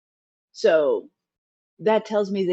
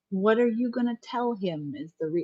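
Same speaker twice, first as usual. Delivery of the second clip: ordinary talk, nothing marked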